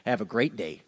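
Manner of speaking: suddenly low and soft, mock-polite; offhand sign-off. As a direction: toss it off after the doom. Breezy sign-off after doom announcement.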